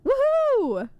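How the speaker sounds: high, loud whoop; cheering woohoo. Real, not acted. Cheering along.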